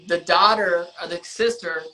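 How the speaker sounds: ordinary talk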